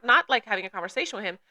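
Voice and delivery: normal talking voice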